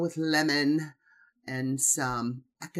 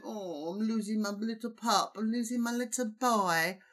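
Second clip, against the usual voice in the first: higher, overly sentimental mock lament; mock whiny Lisa; 6 semitones higher than usual. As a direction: mimic a doting mother clinging to her boy. Mocking Lisa's sentimental lament.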